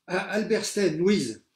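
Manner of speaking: loud high name call; teacher shouting the roll. A narrator's reading.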